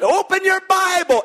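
high, loud pulpit call; mock preacher. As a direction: boom it out like the preacher. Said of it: "preacher gets up".

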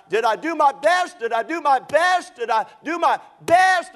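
high, loud, desperate repeated cry; yelling out. Retold or imitated. Injured swimmer in hospital cries out, loud and high. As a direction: cry it out like a delirious man in pain. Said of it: "he starts to yell out".

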